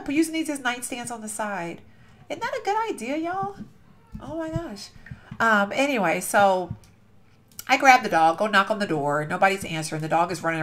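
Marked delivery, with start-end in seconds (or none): 2.3-4.9 high pitched, eager ask; high delighted question. Real, not acted. Asking viewers about the nightstands, high eager delight. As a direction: pull the viewers into your excitement.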